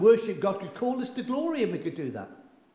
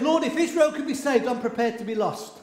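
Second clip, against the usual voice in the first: impassioned plea; 7 semitones higher, 4 dB louder than usual. Acted. Preacher voicing Paul's plea.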